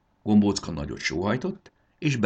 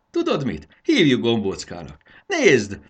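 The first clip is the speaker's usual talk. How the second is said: laughing; 5 semitones higher, 8 dB louder than usual. A narrator's reading. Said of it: "nevetett Marci".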